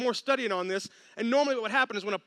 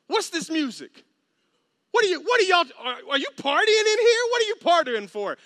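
high, loud outraged questions; mock outraged older brother; indignant complaint; 7 semitones higher, 6 dB louder than usual. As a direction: demand answers, scandalised.

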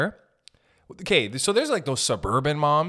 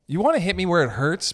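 ordinary talk, nothing marked

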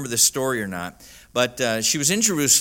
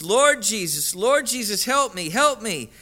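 higher-pitched imitated plea, repeated urgently; retold desperate cry; 8 semitones higher than usual. Woman under attack crying out to Jesus, retold high-pitched plea. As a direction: plead in a raised pitch without shouting. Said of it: "I cried constantly. I said".

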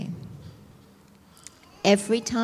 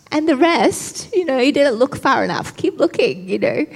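higher, louder mock indignation; indignant retort; 5 semitones higher, 6 dB louder than usual. Preacher voicing her unspoken reply to him, mock indignant. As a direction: toss it back as an offended imagined comeback. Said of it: "that's how I felt".

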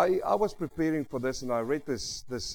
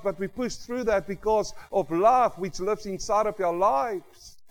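louder, rising preacher's delivery, pushing to the congregation; full-force preaching; 8 semitones higher, 6 dB louder than usual. Acted. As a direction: preach it out with conviction to the brothers and sisters. Preaching to congregation about love.

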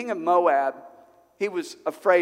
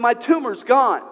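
higher and louder, bursting with it; joyful news; 8 semitones higher, 8 dB louder than usual. Retold or imitated. Healed man tells the pastor his tumor is gone, joyful. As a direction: announce the miracle with joy.

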